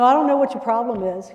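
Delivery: loud, hostile snap; confrontational. Shown as a demonstration. Trainer demonstrating the wrong way to talk to a guest, loud and hostile. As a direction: challenge them aggressively. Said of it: "you don't wanna".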